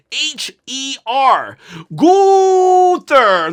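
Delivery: slow spelled letters, then a big high ring-announcer yell of the name; hyped wrestling-announcer shout; announcer name call. Pretend. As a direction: bellow the name like a ring announcer introducing him.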